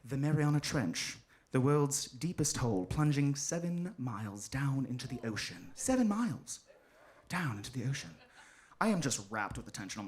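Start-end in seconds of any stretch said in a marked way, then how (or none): 0.0-5.7 low, measured documentary voice; lower, hushed narrator's delivery. Acted. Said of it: "The narrator goes". 5.7-8.1 lightly voiced nature-film narrator; soft, falling narrator cadence; documentary narrator. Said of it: "The narrator goes".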